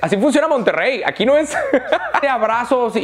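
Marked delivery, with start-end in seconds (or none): 1.0-3.0 words broken by a laugh. Real, not acted. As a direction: laugh through it. Laughing about Monterrey.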